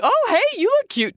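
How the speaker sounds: cutesy self-talk. Retold or imitated. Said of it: "I'm like".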